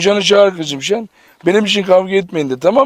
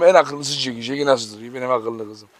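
tender praise; 6 semitones lower, 6 dB quieter than usual. Real, not acted. Praising dog in soft voice.

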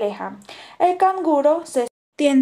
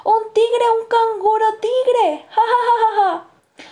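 high, louder mocking laughter; cackling birds; 11 semitones higher, 5 dB louder than usual. A narrator's reading. Los pájaros ríen a carcajadas.

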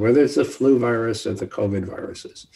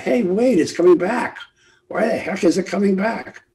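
mock alarmed surprise; 8 semitones higher than usual. Public realizing next year the virus is back, mock surprise. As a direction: pitch jumps higher, startled and puzzled. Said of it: "we're gonna say".

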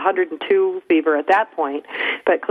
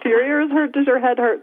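worried parent questions; 6 semitones higher than usual. Retold or imitated. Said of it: "I'm like".

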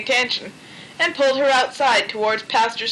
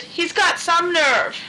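grumble; 6 semitones higher, slower than usual. A narrator's reading. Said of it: "grumbled Margaret".

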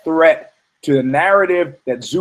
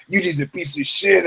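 mock scorn; 5 semitones higher than usual. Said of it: "gossip in New York".

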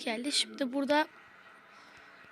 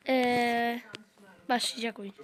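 usual speaking voice

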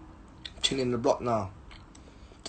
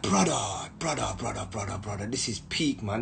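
low, much softer fed-up grumble; muttered complaint; 8 semitones lower, 11 dB quieter than usual. Retold or imitated. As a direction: grumble under your breath. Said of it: "I'm like".

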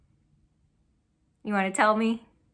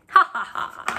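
a light airy pretend laugh; whispered little laugh; breathy mock laugh; 3 semitones higher than usual. Imitating a friend's laugh.

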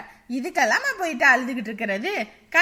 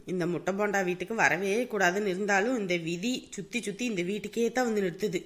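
low, soft grumble to herself; mother-in-law grumbling privately about the in-law, muttered; muttered aside; 8 semitones lower, 6 dB quieter than usual. Acted. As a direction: mutter it so the guests can't hear.

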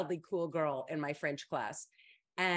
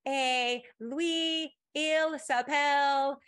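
high, loud, drawn-out bad French accent; terrible French accent; 8 semitones higher, 5 dB louder, much slower than usual. Girl reading her essay to French class, in a terrible French accent. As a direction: read French aloud in a mock terrible accent. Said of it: "with the worst French accent imaginable".

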